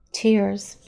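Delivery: ordinary talk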